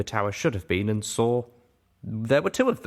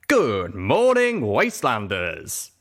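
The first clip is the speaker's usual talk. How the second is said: radio DJ cry; 4 dB louder, much slower than usual. Acted. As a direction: belt it out like a morning DJ. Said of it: "Homage cried into the mic, her voice now male".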